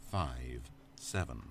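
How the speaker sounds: digits dragged out low and slow; slow and deliberate. A narrator's reading. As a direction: draw the numbers out slowly. Narrator repeats the code slowly.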